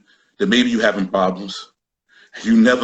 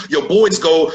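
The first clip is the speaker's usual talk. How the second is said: normal talking voice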